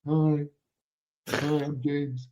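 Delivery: timid intro. Retold or imitated. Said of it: "and I'm like".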